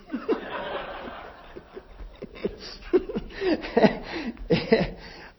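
high unvoiced laughing fit; helpless laughter at the embarrassment; long laughter. Real, not acted. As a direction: crack up at the memory. Laughs at own story.

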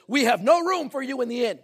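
loud, high, stern proclamation; forceful stern stage line. Acted. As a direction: boom it out sternly, by the book. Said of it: "he's very stern".

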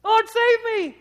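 loud, high-pitched acted yell; desperate cry for help. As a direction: cry out like a drowning disciple. Acts out Peter choking, crying for rescue.

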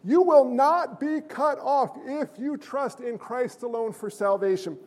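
normal talking voice

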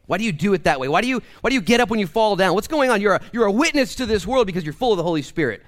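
high, loud pulpit preaching; fiery preacher driving the point home; full-force preaching. Acted. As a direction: preach it out to the back row.

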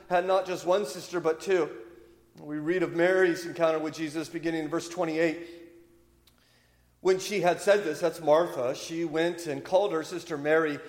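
ordinary talk

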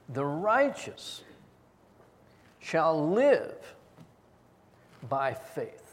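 slow, each word "righteous", "live", "faith" set apart; stressed word by word. Shown as a demonstration. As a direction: lean on every key word in turn. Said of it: "Righteousness, life, and faith".

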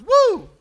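put-on high, loud toddler whoop; dad imitating son Judah, loud high whoop; imitating child's woo. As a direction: belt out a kid's joyful woo. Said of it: "he got out of it and goes".

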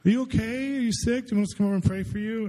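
high-pitched, overly solicitous questions; mock concern. Church caller checking on a latecomer, high-pitched mock concern. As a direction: imitate a prying well-wisher, high and pushy.

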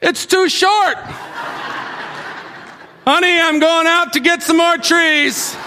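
a high, harried Noah calling to his wife; frazzled high comic Noah; Noah fed up. Retold or imitated. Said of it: "And he's like".

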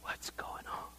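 soft unvoiced whisper; whispered wondering. Curious crowd outside whispering to each other. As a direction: breathe the question. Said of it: "people are outside wondering".

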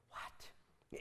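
hushed, breath-only disbelief; mock speechless astonishment; stunned whisper. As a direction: whisper it, as if dumbfounded. Stunned reaction to cavalier answer.

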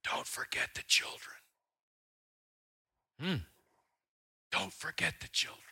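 unvoiced dying whisper; frail whisper. Old woman pleading with the pastor, whispered. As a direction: whisper it with your last strength. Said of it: "with every ounce of energy".

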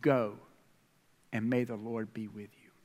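low, solemn blessing; King Saul sending David off; king's blessing. Retold or imitated. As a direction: bless him gravely like a king. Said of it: "You know what Saul said?".

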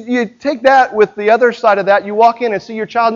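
usual speaking voice

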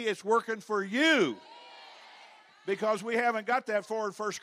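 loud, high pulpit shout, building then easing slightly; full-force preaching. Real, not acted. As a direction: preach it out with all your might.

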